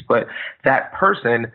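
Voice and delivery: ordinary delivery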